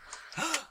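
sharp high gasp of alarm; shocked gasp. Acted. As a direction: gasp in mock horror. Knight gasps in character.

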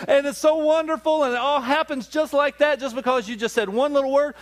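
mock gushing. Mocking the fairy-tale ending.

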